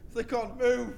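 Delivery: ordinary talk, nothing marked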